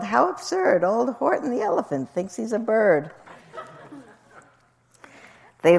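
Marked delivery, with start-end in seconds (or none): 0.0-3.1 high, teasing jeer; mocking crowd, lightly voiced; taunting mock yell. A narrator's reading. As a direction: jeer at him high, without real volume. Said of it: "they yelled".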